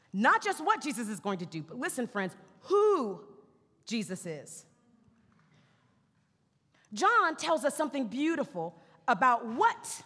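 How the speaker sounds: ordinary talk, nothing marked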